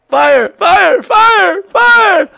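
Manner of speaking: very high, loud alarm shout rising each time; panicked yelling. Retold or imitated. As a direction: scream fire as if the bus is burning. Panicked man yelling about fire.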